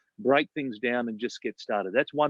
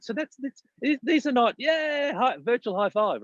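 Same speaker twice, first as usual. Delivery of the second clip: excited; 9 semitones higher than usual. Real, not acted. Virtual high five excitement.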